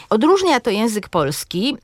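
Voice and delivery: normal talking voice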